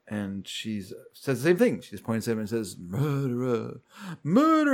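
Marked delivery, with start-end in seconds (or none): 2.8-3.8 slow, stretched-out mock accusation; accusing ghost woman. 4.2-4.7 high, loud mock wail; accusing ghost woman.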